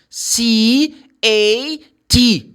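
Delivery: "C A T" slow, loud, letter by letter; spelling out letters. Shown as a demonstration. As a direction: pause after each letter of "C A T", punching it.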